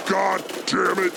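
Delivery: ordinary delivery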